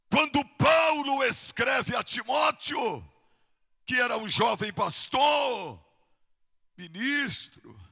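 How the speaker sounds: ringing preacher's call; forceful preaching. Real, not acted. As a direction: proclaim it to the congregation with stretched stresses.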